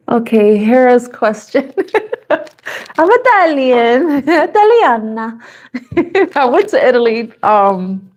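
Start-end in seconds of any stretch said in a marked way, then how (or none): 2.3-5.4 big swooping mock-Italian lilt; sing-song Italian, higher and drawn out; Italian accent. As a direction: stretch it out in a playful Italian accent. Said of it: "I'm doing the Italian accent".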